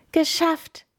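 smiling relief. A narrator's reading. Lenny after rescuing everyone, high and beaming. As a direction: beam with relief. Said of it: "lächelt er".

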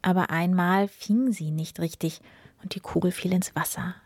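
ordinary delivery

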